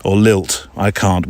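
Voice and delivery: ordinary talk, nothing marked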